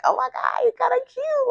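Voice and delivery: mock gushing. Imagining an admirer finding him kinda cute, mock gushing. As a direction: gush lightly in a high voice. Acted gushing over an attractive suitor.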